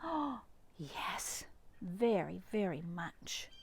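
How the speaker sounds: gasp, then soft and tender; a hushed, heartfelt promise. Acted. Dad answering his son, tender and soft. Dad asked will you miss me.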